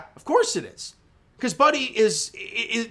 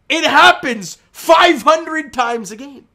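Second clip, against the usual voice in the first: loud emphatic repeat; 6 semitones higher, 8 dB louder, slower than usual. Real, not acted. Repeats point, louder and higher.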